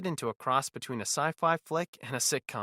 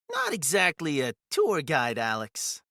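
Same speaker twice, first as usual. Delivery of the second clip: higher, louder smirking character voice; 4 semitones higher, 4 dB louder than usual. A narrator's reading. Blue-eyed man clarifying his offer to Alex, smirking. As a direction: correct him with a smirk in the voice. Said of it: "a hint of a smirk playing on his lips".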